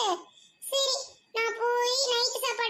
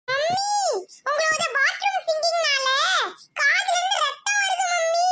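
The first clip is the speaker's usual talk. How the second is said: put-on higher, louder whiny kid; high child voice; 5 semitones higher, 3 dB louder than usual. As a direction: complain like a cheeky child. Kid complains about singing. (சிணுங்கும் குழந்தை குரல்)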